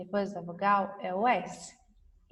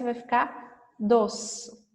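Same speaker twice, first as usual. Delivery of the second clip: slow and clear; slower than usual. Shown as a demonstration. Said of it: "Se eu colocar o S".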